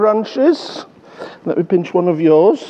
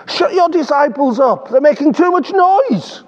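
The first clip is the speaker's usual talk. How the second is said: complaining voice; 9 semitones higher than usual. Retold or imitated. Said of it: "They said".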